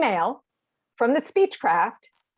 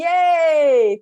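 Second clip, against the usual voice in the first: excited cheer; 6 semitones higher, 6 dB louder than usual. Real, not acted. Cheers the member's answer.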